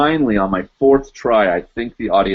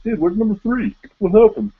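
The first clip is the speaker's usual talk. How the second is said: high, puzzled viewer voice; high bewildered dude voice; confused viewer; 12 semitones higher than usual. Retold or imitated. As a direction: play a baffled fan asking. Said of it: "you'd be like".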